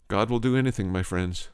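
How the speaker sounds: usual speaking voice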